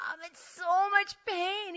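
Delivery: high, slow mock whimper; hammed-up stomach agony; in pain. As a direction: moan it out, stretching the words. Acts out her stomach agony.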